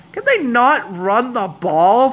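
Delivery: high, slow, emphatic fan complaint; exasperated plea. Real, not acted. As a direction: plead with the coach, dragging out each word. Pleading with his team's play-calling.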